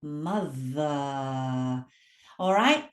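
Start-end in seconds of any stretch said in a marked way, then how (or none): 0.0-1.9 mother drawn out, low, with a long buzzing th; slow MO-THHH-er, th voiced. Shown as a demonstration. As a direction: hold the humming th in mother. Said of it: "feel a vibration".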